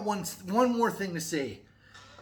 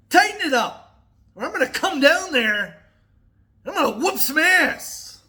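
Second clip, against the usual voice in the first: louder, higher mock threat at Amazon; 7 semitones higher, 5 dB louder than usual. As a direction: bluster at Amazon, half in jest. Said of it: "Just kidding".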